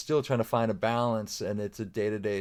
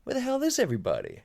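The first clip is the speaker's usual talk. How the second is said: high, quick puzzled cry; mock bewilderment; 13 semitones higher, faster than usual. Dad alone in an empty house by evening, comically bewildered. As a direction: act out sudden lonely bewilderment. Retold loneliness after hours alone.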